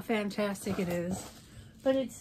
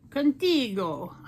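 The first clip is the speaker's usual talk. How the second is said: put-on slow, a touch louder, cod Transylvanian accent; mock Count Chocula accent; 4 dB louder, slower than usual. Vlogger announcing a shade name in a made-up vampire accent. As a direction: drawl it like a cartoon vampire count. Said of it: "making up this Count Chocula accent".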